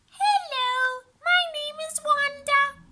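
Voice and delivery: put-on high little girl voice; chatty girl. A narrator's reading. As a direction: greet brightly like a chatty kid.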